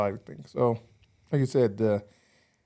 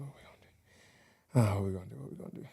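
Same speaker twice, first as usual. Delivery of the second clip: thinking aloud to self; 4 semitones lower, 12 dB quieter than usual. Retold or imitated. Alone in dark bathroom, thinking.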